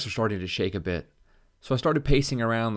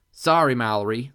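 nasal voice; 3 semitones higher, 7 dB louder than usual. A narrator's reading. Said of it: "in her nasally voice".

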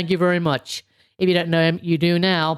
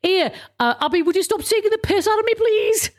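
high-pitched, fast Scouse complaint; mock Scouse, rising higher as it goes; Joey Barton impression in Scouse; 11 semitones higher, faster than usual. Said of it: "Can you imagine if he goes".

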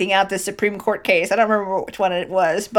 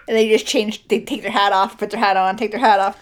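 usual speaking voice

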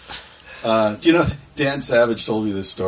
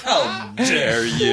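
mock outrage; 2 semitones lower, much slower than usual. Mock offended caller.

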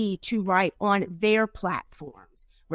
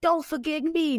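calling out; 8 semitones higher than usual. Retold or imitated. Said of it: "I was always like".

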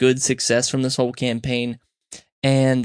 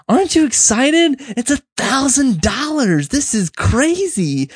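high, louder, gushing imitation of the staff; mock gushing staff; 11 semitones higher, 3 dB louder than usual. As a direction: ham up their over-the-top excitement. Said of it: "they're like".

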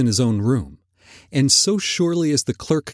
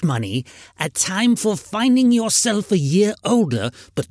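put-on indignant old miser's tirade; scornful, aggrieved Scrooge voice; 4 semitones higher than usual. A narrator's reading. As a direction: rant against the season as a crotchety old man. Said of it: "said Scrooge indignantly".

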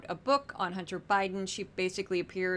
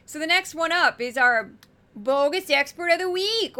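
higher, louder announcer call; big segment announcement; 6 semitones higher, 6 dB louder than usual. Acted. Host hyping the weekly segment to viewers, loud announcer voice. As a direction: boom it out like a game show host.

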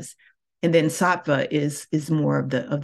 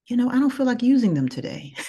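breezy admission; 8 semitones higher, faster than usual. Real, not acted. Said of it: "sometimes it's like".

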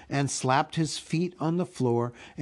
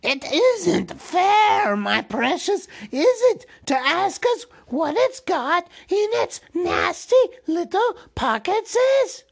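high, loud creature wail; Gollum, frightfully upset; 6 dB louder than usual. A narrator's reading. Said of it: "he was frightfully upset".

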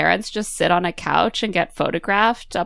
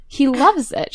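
ordinary talk, nothing marked

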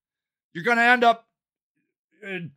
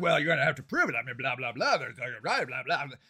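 much lower, softer mumbled quote; mock dismissive police; 12 semitones lower, 9 dB quieter, faster than usual. Police brushing off the caller, dismissive mumble. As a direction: drone the brush-off down into blah-blahs. Mocking police reply in story.

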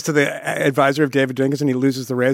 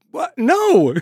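high, sharp disbelief; incredulous; 11 semitones higher, 3 dB louder than usual. Retold or imitated. Acting out the panel's stunned silence, incredulous. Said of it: "there's so much incredulity".

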